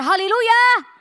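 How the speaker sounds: rising shout, as the congregation calls it; loud congregational hallelujah. Shown as a demonstration. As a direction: call out a loud, high hallelujah to test the crowd. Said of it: "بصوت عالي قوي". (هتاف هاليلويا بصوت عالي)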